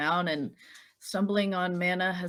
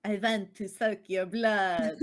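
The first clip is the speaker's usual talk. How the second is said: slow Transylvanian vampire accent; lightly voiced Transylvanian Dracula; slower than usual. Shown as a demonstration. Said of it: "with a vampire accent".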